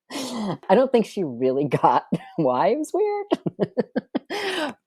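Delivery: laughing through it, high and drawn out. Real, not acted. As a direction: giggle helplessly at the memory. Laughing retelling the awkward talk.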